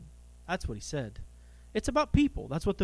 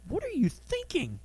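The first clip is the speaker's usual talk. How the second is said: rebuking; 11 semitones higher, 3 dB quieter than usual. Retold or imitated. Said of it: "he rebuked him".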